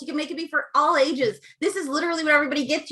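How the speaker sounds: normal talking voice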